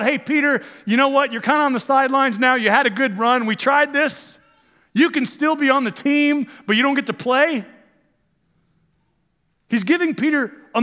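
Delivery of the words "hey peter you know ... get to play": mock dismissive Jesus. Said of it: "It's not".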